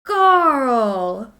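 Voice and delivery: loud, high yell of dismay at the crash; real exasperated yell at the game; shocked shout. Real, not acted. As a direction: cry out the name in disbelief.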